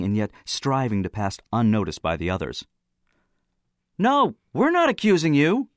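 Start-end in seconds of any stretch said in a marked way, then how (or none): none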